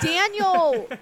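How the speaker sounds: high, loud scold of a name; mock scolding. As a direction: call him out in jest. Mock protest at a co-host's joke.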